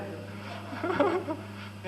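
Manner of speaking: a falling high chuckle; amused chuckle after the story; soft laugh. Real, not acted. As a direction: laugh it away softly. Speaker laughs.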